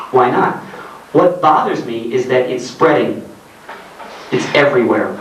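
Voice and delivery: usual speaking voice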